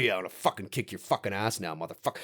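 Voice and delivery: low, fast menace; Freddy growling a threat; menacing villain. Retold or imitated. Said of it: "he's just like".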